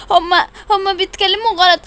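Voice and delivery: usual speaking voice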